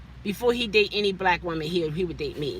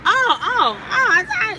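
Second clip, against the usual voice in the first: startled, louder and higher; flustered; 11 semitones higher, 7 dB louder than usual. Real, not acted. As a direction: caught off guard, fumbling. Startled back to topic.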